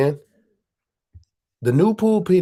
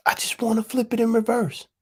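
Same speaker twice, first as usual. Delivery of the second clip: whisper; 7 semitones higher than usual. Shown as a demonstration. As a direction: lean in and whisper it like a secret.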